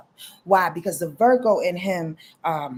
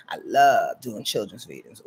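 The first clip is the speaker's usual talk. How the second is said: even lower take; 9 semitones lower than usual. Shown as a demonstration.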